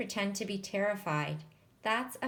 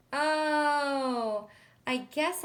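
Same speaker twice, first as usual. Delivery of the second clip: long, high, louder "Oh"; dawning realization; 6 semitones higher, 5 dB louder, much slower than usual. A narrator's reading. Girl learns she missed that part, drawn-out realization. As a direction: draw out the Oh as it sinks in. Narrator realizes her mistake.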